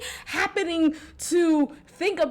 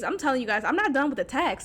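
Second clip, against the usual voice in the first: ordinary talk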